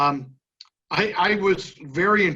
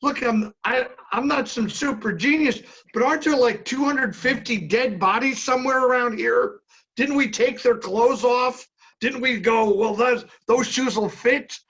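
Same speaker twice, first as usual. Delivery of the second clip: high-pitched incredulous retort; exasperated arguing; 7 semitones higher than usual. Retold or imitated. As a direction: argue the absurdly obvious in a raised pitch. Acted argument with script supervisor.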